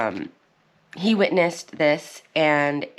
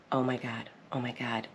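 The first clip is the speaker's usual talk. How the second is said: much softer, lower, under the breath; mortified murmur; 4 semitones lower, 12 dB quieter than usual. Retold or imitated. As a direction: mutter it so nobody nearby hears. Mortified during a public argument.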